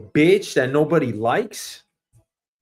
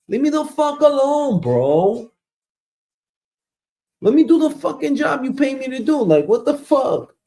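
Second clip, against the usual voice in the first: much higher, exasperated cursing; angry outburst; 9 semitones higher than usual. Acted. Fed up with the boss checking in, a high angry outburst. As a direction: blow up at him in frustration.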